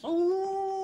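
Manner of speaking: high held "ooh"; hammed-up spooky howl; mock eerie ooh. Son teasing father about snowy wastes, mock wind howl.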